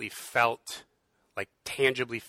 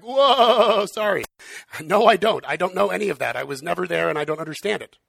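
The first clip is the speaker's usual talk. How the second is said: higher and louder, a hurried sheepish apology; flustered Job; 6 semitones higher, 7 dB louder than usual. Retold or imitated. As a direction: back down fast like a cornered Job. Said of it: "Job is probably like".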